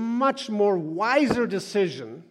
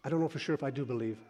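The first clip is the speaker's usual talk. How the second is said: softer, rushed admission; ashamed confession; 3 semitones lower, 8 dB quieter, much faster than usual. Real, not acted. Preacher confessing doubt to the congregation, quiet. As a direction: blurt it out quietly. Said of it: "I'm ashamed to tell you".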